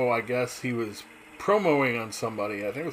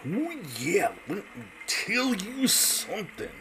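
high, slow, gravel-free Macho Man drawl; Randy Savage; 8 semitones higher, 3 dB quieter, slower than usual. Retold or imitated. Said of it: "Randy Savage would be like".

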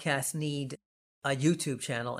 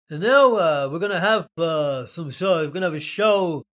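loud, halting mock British accent; bad British accent; 8 dB louder than usual. Imagined podcast guest opening the show, bad British accent. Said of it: "I can't do the British accent very well".

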